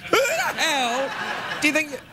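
drawn-out mock indignation, high; mock outrage, slowed. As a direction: stretch the fake fury out. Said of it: "I'm just vamping".

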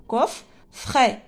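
"Coffret" slowly in two separate beats, cof, fret; split into syllables. Shown as a demonstration. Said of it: "deux syllabes". (« Coffret » scandé en deux syllabes)

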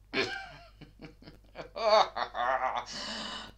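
long bursting crazed laugh; mad cackle. Acted. Madman laughing as he waits for his friend, mad cackle. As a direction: let a loud mad laugh spill out.

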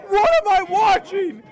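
high, loud, drawn-out cries of disbelief; real awestruck shout; shocked shouting. Real, not acted. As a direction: yell like a stunned esports caster. Caster reacting to a wild play.

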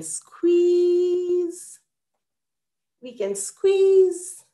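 playful squeeze. Real, not acted. Joking about the small board.

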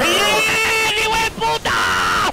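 long, drawn-out high yell; real gamer rage, stretched Spanish curse; outraged shout. Real, not acted. Game loss outburst.

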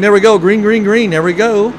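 loud, high green-flag shout; excited race start call. Real, not acted.

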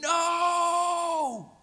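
high, loud anguished scream. A narrator's reading. Husband screaming at his dying wife, anguished shout.

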